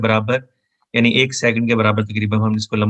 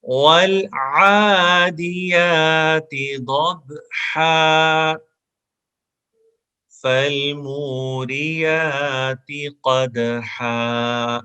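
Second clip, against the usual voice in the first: Quranic recitation; 3 semitones higher, much slower than usual. Shown as a demonstration. Teacher chanting the verse for students to repeat. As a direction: recite each verse slowly for repetition. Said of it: "دونوں آیات کو ہم repeat کریں گے".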